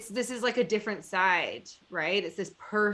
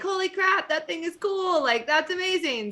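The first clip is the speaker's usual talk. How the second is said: higher, excited mock gush; imitating thrilled fans, pitched up; a light impression of excited fans; excited quote; 9 semitones higher than usual. People reaching out excited.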